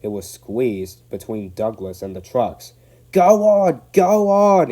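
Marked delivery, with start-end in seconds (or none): 3.1-4.7 very high, very loud scream; van screaming. Acted. The squeezed van screaming at the trucks, panicked. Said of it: "it screamed".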